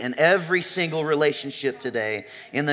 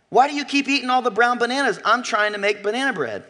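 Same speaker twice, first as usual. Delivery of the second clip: higher, quick exasperated complaint; frustrated wife; 8 semitones higher than usual. Acted. Wife confronting husband over the bananas, exasperated. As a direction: vent like a fed-up wife. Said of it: "gets super frustrated with him and says".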